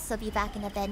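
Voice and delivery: usual speaking voice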